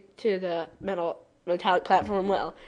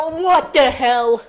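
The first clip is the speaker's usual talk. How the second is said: louder, slow exasperated question; disbelieving outburst; 4 semitones higher, 8 dB louder, slower than usual. Real, not acted. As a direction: blurt it out in disbelief. Reacts to the story.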